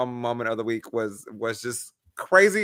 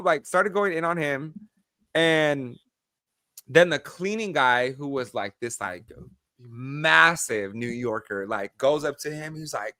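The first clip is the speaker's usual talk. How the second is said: ordinary talk, nothing marked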